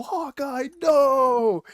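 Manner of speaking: high mock cry, swelling on "no"; Captain America's alarmed cry. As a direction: call out in hammed-up horror.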